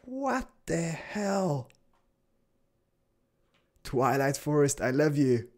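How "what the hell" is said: high, very slow amazement; long-drawn, wondering reaction; stunned disbelief. Real, not acted. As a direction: stretch it out in bafflement. Still baffled by dancing bushes.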